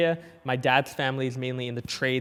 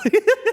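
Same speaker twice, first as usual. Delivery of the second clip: high laugh; 9 dB louder than usual. Real, not acted. Laughs at own joke.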